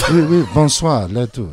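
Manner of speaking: mock French accent. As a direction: toss out French phrases in a put-on accent. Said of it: "with his French accent".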